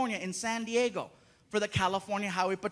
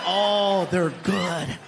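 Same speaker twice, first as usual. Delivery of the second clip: mock alarm; 4 semitones lower, 6 dB louder, much slower than usual. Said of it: "I'm like".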